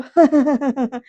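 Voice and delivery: loud rolling chuckle; acted laugh. Varad laughs at Vaidehi's explanation, hearty acted laugh. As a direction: laugh out loud as the amused character. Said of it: "Varad ना हसत म्हणतो".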